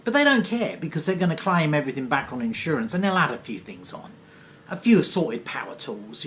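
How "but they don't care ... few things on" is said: normal talking voice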